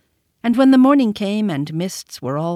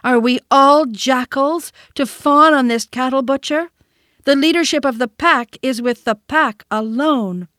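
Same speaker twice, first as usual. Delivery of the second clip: high, loud indignant cry; defiant shout; 6 semitones higher, 5 dB louder than usual. A narrator's reading. Mowgli rousing the council against tiger, loud. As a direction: rouse the pack.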